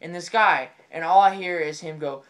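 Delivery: ordinary delivery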